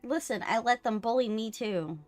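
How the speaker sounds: ordinary talk, nothing marked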